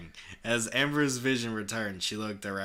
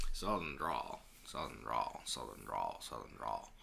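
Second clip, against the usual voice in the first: put-on mumbled practice at a Southern accent, dropping lower; country boy accent; 10 semitones lower, 10 dB quieter than usual. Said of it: "Do a country boy accent".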